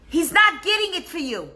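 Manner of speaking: wife delivering the punchline; a high wife voice, pleased; triumphant wife. Retold or imitated. Wife's punchline to neighbor.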